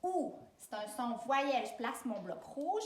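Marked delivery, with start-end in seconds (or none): 0.0-0.3 OU as an isolated, held "oo"; vowel sound. Shown as a demonstration. Teacher isolating the next sound of journée, OU sounded alone. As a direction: hold OU as one pure vowel. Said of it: "Je prononce les sons".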